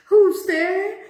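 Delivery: high, loud nervous croak. Acted. Frightened boy calls toward the bush. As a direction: call out scared into the dark. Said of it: "he croaked nervously".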